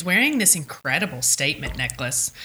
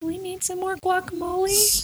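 quoting Janine; 13 semitones higher, 4 dB quieter than usual. Retold or imitated. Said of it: "in his infinite wisdom, said".

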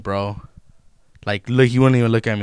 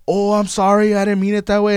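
much higher, flustered voice of the caught-out worker; shocked apology; 10 semitones higher, 3 dB louder than usual. Retold or imitated. Imitating the Sbarro worker apologizing, higher and flustered. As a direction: squeak out a startled apology. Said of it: "like, so shocked".

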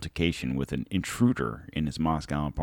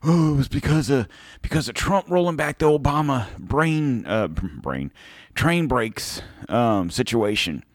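mocking Twitter crowd; 6 semitones higher than usual. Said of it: "people on Twitter and whatnot going out there saying".

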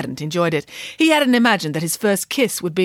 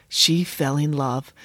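tender; 5 semitones lower, slower than usual. A narrator's reading. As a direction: let it melt. Princess falls in love.

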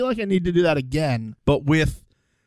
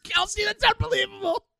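high, laughing near tears; 13 semitones higher than usual. Real, not acted. Laughing hard at Kelsey's message with her co-host. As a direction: crack up at the listener message.

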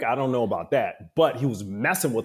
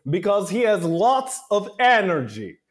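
high, loud, slow teacher voice showing off to the class; imitating the acting professor; 9 semitones higher, 5 dB louder than usual. Acting professor lecturing the class about the broken student, high and loud. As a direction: announce it to the whole class, drawn out. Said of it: "and he's like".

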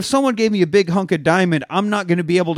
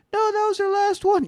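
panicked teammate. Retold or imitated.

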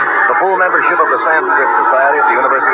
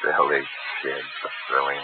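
muttered aside; 9 semitones lower, 12 dB quieter than usual. Acted. Sam covering after the call.

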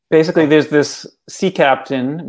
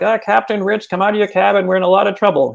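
urgent calling out; 6 semitones higher, faster than usual. Retold or imitated. Crew pounding on captain's door.

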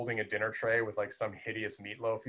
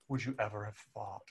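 gently mournful, softer Morticia; wistful, under the breath; soft sorrowful quote; 6 dB quieter than usual. Retold or imitated. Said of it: "the sorrow in her face".

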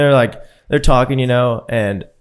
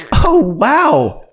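mock amazement; 10 semitones higher, 4 dB louder than usual. Mocking Russian driver.

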